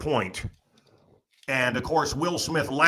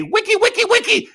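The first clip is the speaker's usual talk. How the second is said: very high squeaky wiki wiki scratch sound; mock DJ scratch; 5 dB louder than usual. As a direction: imitate a DJ scratching records. Imitating DJ scratching.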